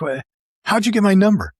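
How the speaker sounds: outraged that a stranger got through; indignant demand. Retold or imitated. Investor demands how the caller got his number, indignant.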